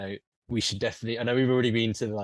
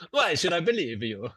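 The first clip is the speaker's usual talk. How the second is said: hammed-up Italian, pitched higher; outlandish Italian accent; 5 semitones higher than usual. Game master as Roman lanista, outlandish Italian accent. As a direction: exaggerate the Italian lilt. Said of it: "you could do an outlandish Italian accent".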